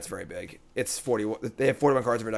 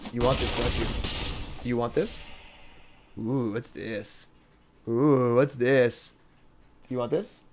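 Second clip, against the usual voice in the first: tempting devilish whisper; 2 semitones lower, 5 dB quieter than usual. Acted. Teasing the dog with a treat.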